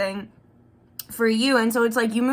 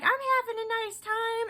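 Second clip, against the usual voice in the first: high, airy and breezy; light singsong higher voice, unbothered; carefree partner voice; 10 semitones higher, 5 dB quieter than usual. Pretend. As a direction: chirp it like you haven't a care. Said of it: "You're like".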